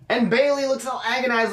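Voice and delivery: ordinary delivery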